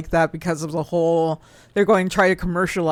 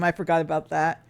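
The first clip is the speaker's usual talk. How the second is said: normal talking voice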